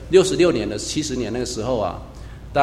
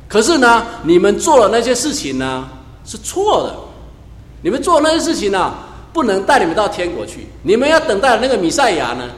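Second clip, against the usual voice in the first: 提高嗓门，像耶稣对百姓大声宣告; 一句句往上顶，带着急切责备; 激动宣讲; 5 semitones higher, 4 dB louder than usual. Retold or imitated. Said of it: "他说". (耶稣对众人宣讲，声音高亢响亮)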